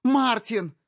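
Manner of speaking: high piercing scream of his name; shriek. Acted. Wife screaming for her husband, piercing shriek. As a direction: scream for him in terror. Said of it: "Колет завизжала".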